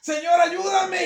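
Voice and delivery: shouted, high and slow; shouted plea. Acted. The believer calling out again to the Lord, shouting. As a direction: shout the plea even harder. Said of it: "Como que usted esté".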